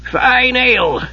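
put-on high, savouring old man's voice; old wizard. A narrator's reading. Old wizard just out of the inn, savouring. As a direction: relish it as an elderly eccentric. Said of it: "he was heard to remark".

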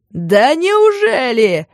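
slow, drawn sarcastic blast; mock explosive outburst. A narrator's reading. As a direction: burst out mockingly. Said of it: "взорвался Витя".

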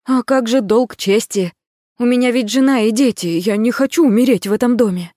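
desperate pleading. A narrator's reading. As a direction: plead for your life, loud. Pleading tied up.

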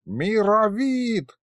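high, very drawn-out yell of a name; long exasperated call; bellowing. A narrator's reading. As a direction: holler it long and high at an old man. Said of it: "снова заорал дед".

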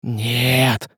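understated, strained cry; desperate cry. A narrator's reading. Казимир sees the knife taken away, restrained desperate cry. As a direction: protest in despair without much volume. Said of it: "закричал Казимир".

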